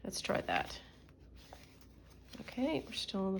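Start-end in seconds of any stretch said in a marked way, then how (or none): 0.0-0.8 whispered aside. Real, not acted. Muttered aside while crafting.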